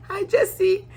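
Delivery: high, cutesy flirty hello; mock sweet greeting. Debbie flirting upstairs with Jesse, high and cutesy.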